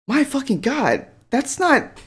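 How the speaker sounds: high-pitched exasperated complaint; fed up, voice jumping high. Real, not acted. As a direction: pitch it up in disbelief and disgust. Exasperated reaction to the clip.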